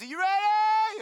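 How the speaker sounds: high loud shout across the field; friend calling out. Retold or imitated. Boyhood friend shouting to him before the kick, high and loud. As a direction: yell it before kicking the ball. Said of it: "and he says".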